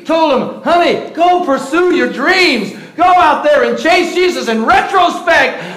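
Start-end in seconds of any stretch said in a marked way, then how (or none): 0.6-4.5 breezy, higher mock-confident advice; smart aleck advice. Pastor quoting his younger smart-aleck self to his kids. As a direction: play the know-it-all parent. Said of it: "smart aleck me".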